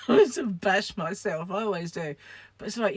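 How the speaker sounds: normal talking voice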